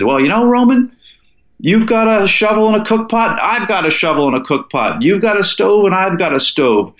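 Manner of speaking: high, lilting Southern drawl for his climbing partner; funny Southern drawl. Retold or imitated. Partner on the ridge proposes splitting up, in a Southern drawl. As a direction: drawl it out like a good old Southern boy. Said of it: "he had this sort of funny Southern drawl".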